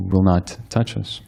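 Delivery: ordinary talk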